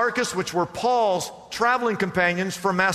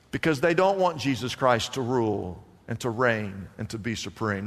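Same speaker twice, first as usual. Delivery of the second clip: usual speaking voice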